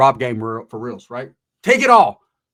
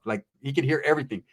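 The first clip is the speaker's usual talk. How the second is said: normal talking voice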